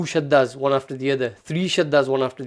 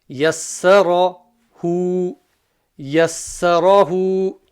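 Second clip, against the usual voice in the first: very slow yassarahu; slow blended demo; 3 semitones higher, 4 dB louder, much slower than usual. Shown as a demonstration. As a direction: read yassarahu slowly, each syllable clear. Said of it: "blending syllables demo".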